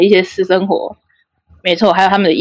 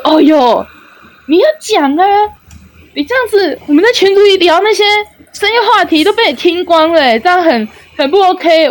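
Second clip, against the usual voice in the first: much higher, whiny retold scolding; mock complaint; 9 semitones higher than usual. Guy complaining she overheard their late-night talk, high whiny. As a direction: protest as if embarrassed and betrayed.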